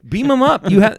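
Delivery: high, loud call to beam them; exasperated exclaim. Retold or imitated. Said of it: "I'm like".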